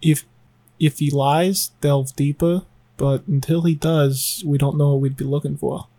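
low, soft, measured instruction; hushed reply. Acted. Alandi secretly instructing the mind reader, hushed. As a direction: keep it down and advise discreetly. Answers the whispered question in character.

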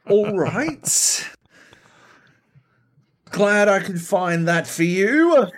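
high, loud sarcastic call; exaggerated put-out retort; mock indignation. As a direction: call it out slowly, aggrieved and sarcastic. Acted indignant reaction to stolen kill.

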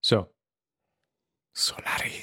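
hushed, dramatic whisper. Acted. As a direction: breathe the title like a movie trailer. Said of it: "Tim's already in his dramatic voice".

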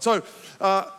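ordinary delivery

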